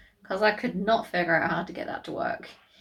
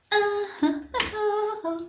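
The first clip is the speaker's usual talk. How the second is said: mock laugh; 11 semitones higher, slower than usual. Mock laugh after song.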